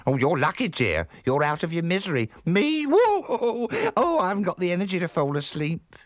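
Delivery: high, swooping character voice with squeals; chatty, solicitous housekeeper. Acted. Missus E advising the aunt about sleep, chatty high voice. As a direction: gossip on in a high, lurching voice.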